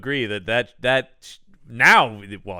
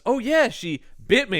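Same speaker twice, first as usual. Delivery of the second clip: higher, offhand mock voice; imitating Jeremy; 8 semitones higher than usual. Jeremy tells Ralph about the bite, offhand and higher. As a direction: toss it off lightly in a raised voice. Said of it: "and he goes".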